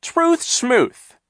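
high, loud scoffing brush-off; dismissive sneer. Acted. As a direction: wave the truth away with contempt. Captain Sham dismissive.

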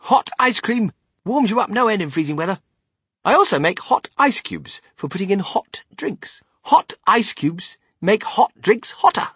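high, loud, emphatic showman; Willy Wonka, boasting. Acted. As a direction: pitch the inventions with gusto.

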